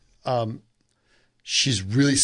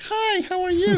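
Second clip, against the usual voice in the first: put-on very high, bubbly girlish voice; high-pitched little girl. Blonde set designer greeting carpenters, high-pitched and bubbly. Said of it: "She has a very high-pitched voice".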